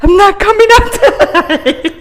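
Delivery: high, loud, slow and laughing; mock refusal. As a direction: play the stubborn refusal, hammed-up. Boyfriend refusing to come out.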